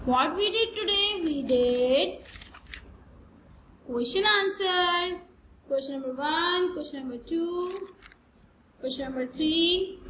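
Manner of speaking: slow, drawn-out teacher summary; slow lesson recap. Acted. Teacher wrapping up the video lesson, slow and drawn out. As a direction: spell out the day's work slowly for the kids.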